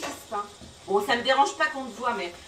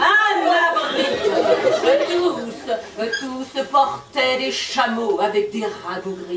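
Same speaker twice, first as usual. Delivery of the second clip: emphatic dramatic verse; theatrical poetry delivery; poem recitation; 7 dB louder, much slower than usual. Acted. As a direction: declaim it with rolling momentum.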